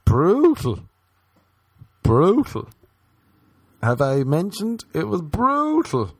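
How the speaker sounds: loud, high hammed-up wail; mock anguish. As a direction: cry out the word like it was torture. Loud exaggerated complaint about jar.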